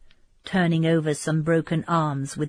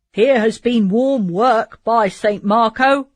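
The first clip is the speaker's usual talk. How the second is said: loud, slow rough soldier exclaiming; a veteran marvelling at the battlefield; hearty soldier's cry; 4 semitones higher, 7 dB louder, slower than usual. Acted. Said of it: "cried Bertrand".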